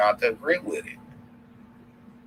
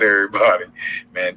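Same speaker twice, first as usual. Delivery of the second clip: normal talking voice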